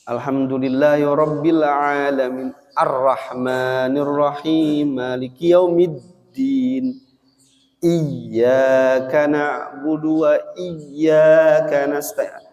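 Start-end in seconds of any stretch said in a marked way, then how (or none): 2.7-12.4 arrahmanirrahim, maliki yaumiddin recited slowly, doubling the tasdid; slow recitation. Shown as a demonstration. Teacher reciting Al-Fatihah to show the tasdid. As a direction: recite it slowly and hold each doubled letter. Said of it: "ada tasdid".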